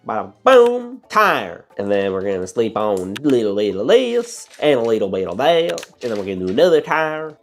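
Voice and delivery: hammed-up southern drawl; Tow Mater. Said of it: "I'm slipping into a southern drawl".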